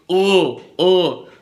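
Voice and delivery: ordinary talk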